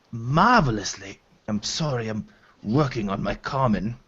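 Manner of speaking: gently reassure, stumbling over the language; lightly voiced hobgoblin woman.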